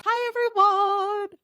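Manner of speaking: ordinary talk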